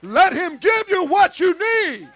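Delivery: preaching at full force. Acted. Preacher urging the congregation to let God in, full-force preaching.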